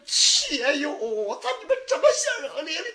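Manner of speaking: loud, tearful laughter; laughing through tears. Acted. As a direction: laugh and cry at once. 喜极而泣的相认. (喜极而泣)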